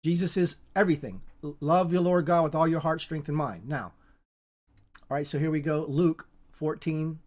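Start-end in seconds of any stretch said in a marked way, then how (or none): none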